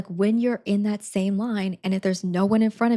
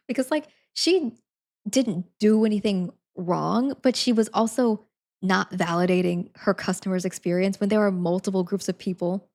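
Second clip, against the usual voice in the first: ordinary talk, nothing marked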